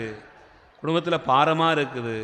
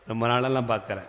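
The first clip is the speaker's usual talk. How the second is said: usual speaking voice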